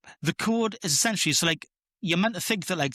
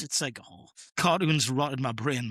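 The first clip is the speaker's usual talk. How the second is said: ordinary delivery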